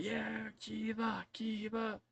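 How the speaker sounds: hushed, high crowd cheer; a distant crowd chanting, drawn out and soft. Acted. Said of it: "a thunderous applause, and they're all like".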